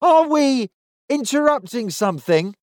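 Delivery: high, sneering fake courtesy; polite on top, sneer underneath; mock politeness. A narrator's reading. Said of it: "asked the centurion in mock politeness".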